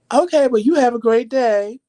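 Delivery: bright, high, sugary brush-off; overly cheerful fake farewell; fake sweet goodbye. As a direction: sweetly get rid of her. Fake-polite send-off to coworker.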